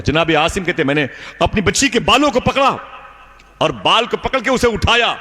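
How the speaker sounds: climbing high, impassioned telling; rising preaching fervour. Acted. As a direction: build the drama up. Preacher builds up the scene. (بلند جوشیلی آواز میں بیان)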